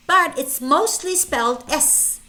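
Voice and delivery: ordinary delivery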